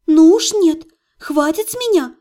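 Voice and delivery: indignant, rising to a high cry; barking protest. A narrator's reading. Patient refusing to be soothed, indignant high bark. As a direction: protest firmly, then let the last command leap up high. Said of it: "рявкнула я во всю мощь лёгких".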